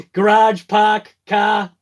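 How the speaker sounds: punchy Boston "Garage, park, car", no R's; loud Bostonian "Garage, park, car" with open ahs; Boston accent. Shown as a demonstration. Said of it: "but normally it's park".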